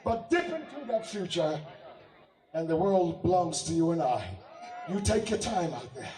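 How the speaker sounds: loud, slow campaign oratory building to a shout; a politician rallying voters from the stage; rousing rally speech. Acted. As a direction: fire up the crowd to vote.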